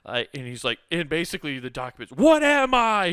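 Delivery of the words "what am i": high, loud cartoon cry of surprise; startled cry. Document character cries out.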